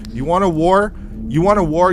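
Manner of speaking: high, loud goading questions; mock-threatening, fired-up taunt; angry challenge. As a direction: dare them to take you on. Challenges his doppelgangers to war.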